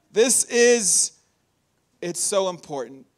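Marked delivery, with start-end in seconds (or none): none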